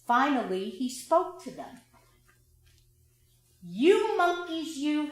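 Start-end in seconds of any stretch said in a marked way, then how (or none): 3.6-5.1 a peddler scolding the monkeys, a bit louder; mild scolding. A narrator's reading. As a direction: wag a finger and scold. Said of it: "he said, shaking a finger at them".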